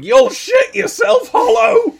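very high, loud, drawn-out holler; mock-yell it slowly, right at the camera; shouted advert line. Imitating the laxative advert lady to a co-host, loud high shout.

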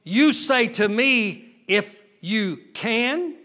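slow, disbelieving 'If you can?' with a rising tune; Jesus echoing the father's doubt, slow and questioning; incredulous slow question. Acted. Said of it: "And Jesus said unto him".

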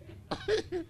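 high, light laugh at his own joke. Real, not acted. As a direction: chuckle at the punchline.

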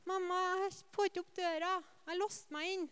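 put-on a small boy stuck behind a door; four-year-old son calling to his mother; little boy voice.

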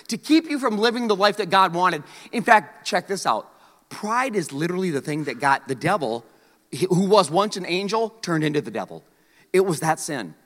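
normal talking voice

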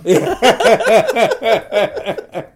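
bright burst of laughter. Real, not acted. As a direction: laugh out, high and loud. Laughs at being busy.

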